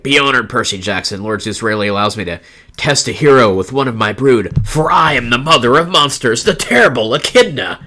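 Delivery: booming over-the-top monster mother; Power Rangers villain. Acted. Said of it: "she sounds like a Power Rangers villain".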